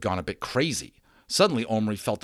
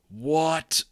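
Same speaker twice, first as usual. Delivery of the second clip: gasped what; 7 semitones higher than usual. Acted. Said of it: "Omri gasped".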